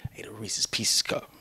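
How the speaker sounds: whispered and sheepish; stoned whisper; whispered confession. Retold or imitated. Stoned answer to wife.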